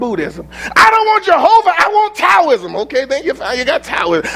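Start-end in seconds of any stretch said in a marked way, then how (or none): 0.8-2.8 high, pushy mock voice of a young doubter; mock young person. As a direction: play a stubborn youth demanding his own pick. Mocking a young person's choice.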